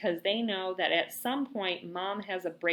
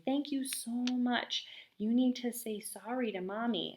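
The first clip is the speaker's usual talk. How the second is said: gentle praise; 4 semitones higher, 3 dB quieter than usual. Retold or imitated. Mom praising toddler.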